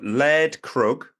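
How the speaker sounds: "Laird Krug" said very slowly, each part spelled out; slow, careful try at "Laird Krug"; careful name. Real, not acted. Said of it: "I am murdering your name".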